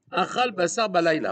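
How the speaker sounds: chanted Hebrew text read out loud; reading the question from the text aloud. Acted. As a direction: intone the Hebrew like a study text. Rabbi reads Hebrew question aloud.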